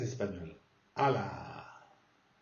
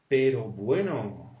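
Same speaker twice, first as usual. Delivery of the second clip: exclaimed pero bueno; 5 dB louder, slower than usual. Shown as a demonstration. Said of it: "montrer une indignation ou ... surprise".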